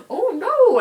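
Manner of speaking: high, loud panicked exclamation; sudden horror, shrieked out; retold alarmed cry. Boy driver realizes he ran her over, high panicked cry.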